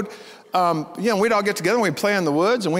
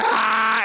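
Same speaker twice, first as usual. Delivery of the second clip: scream; 3 semitones higher than usual. Retold or imitated. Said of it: "I was totally freaked out".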